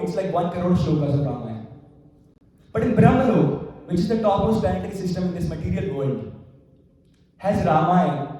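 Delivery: normal talking voice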